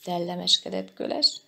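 usual speaking voice

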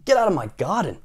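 high, cross order; Mister Grumpy. A narrator's reading. Grumpy man ordering neighbour out of his garden, crossly. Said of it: "yelling at Mister Happy".

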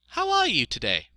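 high-pitched, loud piglet voice; little piglet, polite. A narrator's reading. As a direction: ask politely in a small pig's voice.

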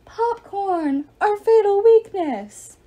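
hammed-up Hazel impression; Hazel imitation. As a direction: send up your friend's faint sigh. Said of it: "Leo did his best Hazel imitation".

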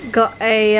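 normal talking voice

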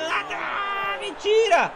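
high, loud disbelieving cry; shocked shout. Real, not acted. Late miss in match.

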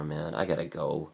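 ordinary delivery

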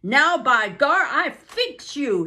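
angry driver threatening Buck, loud and slow; angry threat. Acted. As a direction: threaten the dog, furious. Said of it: "Francois was angry".

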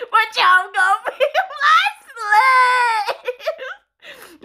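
high, very slow, laughing through it; barely holding the words together. Real, not acted. Streamer teasing game pets, laughing hard.

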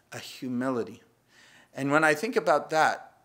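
ordinary talk

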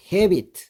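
헤비트 with the final t barely sounded; soft t. Shown as a demonstration. Teacher showing 헤비트 with a weak final t. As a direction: say 헤비트 letting the t fade almost silent. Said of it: "T 발음은 아주 약하게 합니다".

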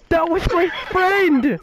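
high, loud, drawn-out protest; outraged shout. Real, not acted. Player protests a friend being shot, outraged shout. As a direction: cry out in indignation, stretching the words.

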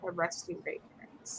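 whispered dreamy aside; hushed longing aside. Real, not acted. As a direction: breathe it like a wish. Dreamy aside about a dream dog.

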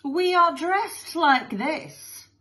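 high, drawn-out explaining voice; Missus Lovey-Dovey. Acted. Mother addresses the assembly, high and gushing. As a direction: explain grandly to the whole hall.

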